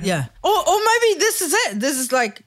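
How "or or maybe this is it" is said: jumps up high; high exclaim. Pretend. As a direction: pop up high in surprise. Voicing God's view.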